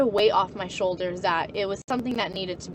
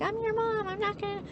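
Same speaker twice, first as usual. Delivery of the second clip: much higher, mock dismissive mom; imitating mom; 12 semitones higher, 5 dB quieter than usual. Mom refuses to knock, high mocking voice. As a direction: squeak it like a bratty parent. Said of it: "She's like".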